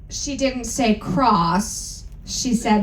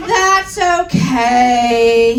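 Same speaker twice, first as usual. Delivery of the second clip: depressed; 5 dB louder, much slower than usual. Acted. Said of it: "he said, all depressed".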